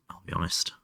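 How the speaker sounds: usual speaking voice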